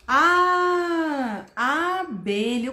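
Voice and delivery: long sustained A, then "abelha"; extended vowel. Shown as a demonstration. Teacher modelling the letter sound, long A then "abelha". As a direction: hold the A alone before saying "abelha". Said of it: "Vogal estendido".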